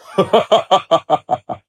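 loud, scornful belly laugh; mocking lion laugh. A narrator's reading. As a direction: laugh heartily at the tiny mouse. Lion laughs at the mouse's offer.

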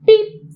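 bright toot from a little truck; quick high truck beep; truck horn beep. A narrator's reading. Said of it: "said Blue".

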